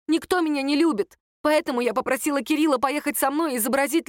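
agitated, self-lacerating outburst; bitter self-reproach. A narrator's reading. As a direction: flaunt the failures in frustration. Sister berates herself before family.

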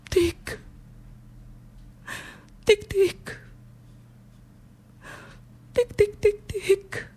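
tic tic acuti e staccati, molto più in alto; ticchettio imitato. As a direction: imita il ticchettio degli occhiali neri. Imita il ticchettio degli occhiali. (ticchettio acuto imitato con la voce)

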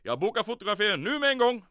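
loud, eager German officer voice; booming with delight; eager shout. Acted.

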